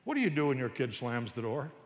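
normal talking voice

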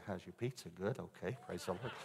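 covering for himself quietly; husband stalling to his wife at the table, hushed; hushed aside. Retold or imitated.

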